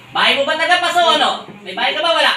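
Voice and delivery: loud demanding question. Real, not acted. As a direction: demand an answer. Healer interrogates spirit. (malakas na tanong)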